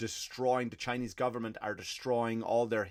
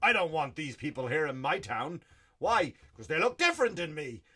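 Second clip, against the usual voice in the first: louder put-on voice of a fearful local; lightly hammed-up small-town bigot; mock bigot; 4 semitones higher, 4 dB louder than usual. Comic mocking locals who fear newcomers, a lightly voiced bigot.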